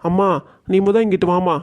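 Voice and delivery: ordinary talk, nothing marked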